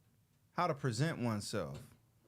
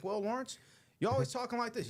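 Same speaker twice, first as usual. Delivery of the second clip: higher-pitched mocking quote of a professor; mimicking the professor; 10 semitones higher than usual. Student retelling the professor's complaint about his speech, mimicking. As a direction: imitate a condescending professor, pitched up. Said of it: "He was like".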